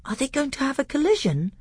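lightly voiced awestruck boy, high and quick; high, hurried question with a touch of awe. A narrator's reading. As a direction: ask in mild awe, pitched well up. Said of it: "he asked in an awestruck voice".

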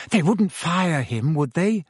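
a mildly anxious plea for reassurance. A narrator's reading. Hermione asks her friends about Hagrid being fired, anxious. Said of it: "said Hermione anxiously".

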